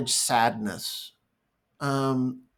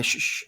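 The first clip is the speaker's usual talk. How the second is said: short double shh; shushing; 5 dB quieter than usual. Retold or imitated. Parent cutting off a child's tears, shushing. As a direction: hush the child with a quick shh. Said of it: "hushed and shushed".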